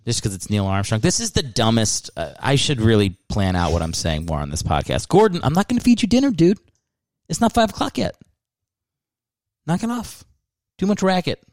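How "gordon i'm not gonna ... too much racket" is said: scolding the dog. Real, not acted. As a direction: tell the pet off, exasperated. Scolding his dog Gordon.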